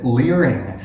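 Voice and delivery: usual speaking voice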